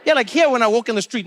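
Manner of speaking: ordinary delivery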